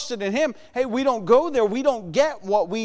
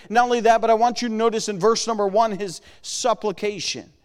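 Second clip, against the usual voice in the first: ordinary delivery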